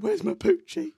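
very high, loud mock wail; hammed-up childish howl; crying child. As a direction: imitate a bawling little brother. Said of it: "my brother was crying".

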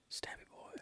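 spooky whispered menace; creepy whisper. Acted. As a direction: breathe the threat like a movie creep. Menacing aside after the stab joke.

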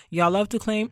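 ordinary talk

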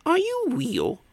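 put-on tiny high-pitched child voice; bobble hat. A narrator's reading. Child questioning the Hogfather, high little voice. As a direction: squeak it out like a curious little kid. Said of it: "said the bobble hat".

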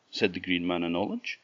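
ordinary delivery, nothing marked

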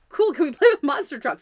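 mother imitating an eager little boy; carefree four-year-old. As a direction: play a kid who has already moved on. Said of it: "four-year-olds is they're like".

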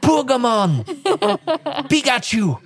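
high, punchy mock Geordie; sing-out Geordie catchphrase; Geordie accent. Shown as a demonstration. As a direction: call it out in exaggerated Geordie. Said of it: "That's what I do".

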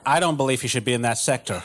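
put-on a deliberately terrible American accent, half laughing; bad American accent. Comedian mocks his own failed audition in a bad American accent. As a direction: mangle an American accent on purpose. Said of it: "my American accent is so shit".

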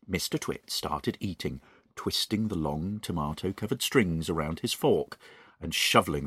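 quiet, soft narrator voice; story narration. A narrator's reading. Host reading The Twits aloud, soft narration. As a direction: drop back down to the gentle reader.

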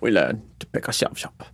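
Alfred impression. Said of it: "Alfred line".

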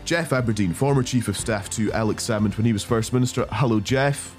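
ordinary delivery

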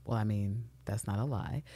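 low, soft and hesitant; imitated sheepish admission. Eve caught out by husband, imitated sheepish mumbling. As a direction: concede it quietly, as if caught out.

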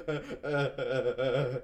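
loud, slow stuttered "uh"s, laughing; imitated speechless sobbing. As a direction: act out being too overcome to talk. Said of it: "'cause I couldn't talk".

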